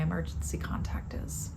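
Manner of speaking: barely voiced, hushed realization; whispered as the loneliness sinks in. Real, not acted. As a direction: let it drop to a whisper. Whispered realization of being alone.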